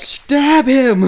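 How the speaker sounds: high jeering shout; mock heckle. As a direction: heckle like a rowdy fan. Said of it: "mock crowd heckle".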